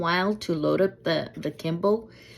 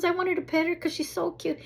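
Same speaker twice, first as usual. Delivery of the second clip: high, sustained pleading imitation; pleading stranger; 10 semitones higher than usual. Stranger wanting to pet a cute dog, high plea. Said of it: "She goes".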